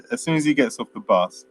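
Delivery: usual speaking voice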